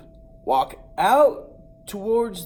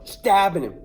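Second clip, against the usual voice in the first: ordinary talk, nothing marked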